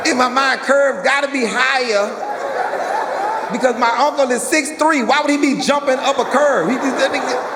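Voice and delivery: can't believe what he saw; squeaky stunned disbelief; incredulous disbelief. Real, not acted. Incredulous about uncle jumping curb.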